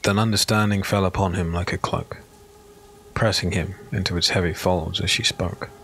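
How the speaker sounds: ordinary talk, nothing marked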